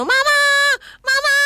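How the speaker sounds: high-pitched mock wail for mum; imitating tearful child. Boarding-school child calling for mother every day, mock wailing. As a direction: cry out like a homesick child, hammed up. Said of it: "sing nangisi aku bendino".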